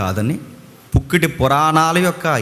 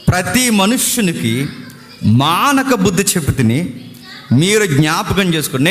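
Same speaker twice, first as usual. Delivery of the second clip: solemn raised scripture reading; 5 semitones higher than usual. Acted. Reads scripture aloud.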